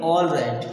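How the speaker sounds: "all right" said as "awl right"; rounded O to open "all right". Shown as a demonstration. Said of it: "क्या पढ़ेंगे".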